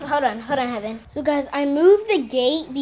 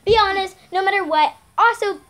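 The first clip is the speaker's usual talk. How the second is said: upbeat, fast youtuber sign-off; vlog outro; 4 semitones higher, faster than usual. Acted. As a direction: rattle off the channel plug.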